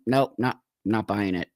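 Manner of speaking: ordinary talk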